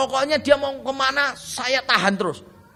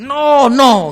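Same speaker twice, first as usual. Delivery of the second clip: put-on loud, drawn-out "no, no" to a child; firm parental no at full volume; larangan tegas; 3 semitones higher, 11 dB louder than usual. Shown as a demonstration. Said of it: "anak kita tuh tahu". (larangan keras dengan suara lantang)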